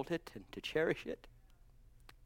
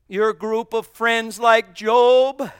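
slow preached emphasis; 6 semitones higher, 13 dB louder, much slower than usual. Acted. Slow loud preached line.

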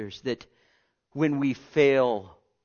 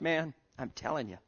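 ordinary delivery

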